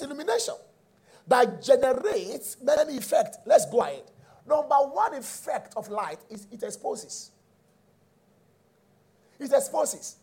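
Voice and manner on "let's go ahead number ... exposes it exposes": ordinary delivery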